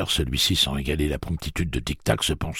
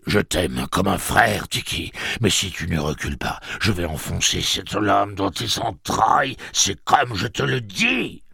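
agitated threat; 6 semitones higher than usual. A narrator's reading. Gâcheur retreating with his dagger, threatening with mounting agitation. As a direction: threaten desperately, rising as you go.

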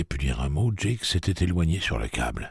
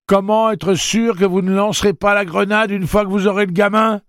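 high, loud, urgent shout; 9 dB louder than usual. A narrator's reading. Eddie challenging the man with the grenade, shouting. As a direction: cry out the challenge, pitched way up. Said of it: "cria Eddie".

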